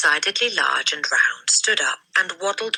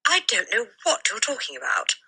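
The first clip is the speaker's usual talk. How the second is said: put-on puzzled boy; 8 semitones higher than usual. A narrator's reading. The boy answers the headmistress baffled. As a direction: say it utterly confused. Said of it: "more puzzled than ever".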